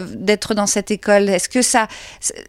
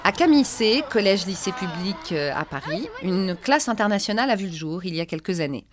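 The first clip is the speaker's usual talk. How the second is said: documentary voice-over; 5 dB quieter than usual. A narrator's reading. Narrator presenting the school to viewers, calm reportage read.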